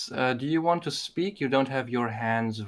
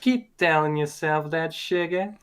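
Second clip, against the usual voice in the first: Rogue's Southern drawl; 4 semitones higher than usual. Retold or imitated. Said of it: "Marvel Rivals with Rogue".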